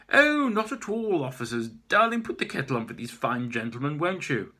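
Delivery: high, sweet put-on hostess voice; mock-sweet hostess voice; sugary fake charm. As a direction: lay the charm on thick. Maeve feigning charm to police.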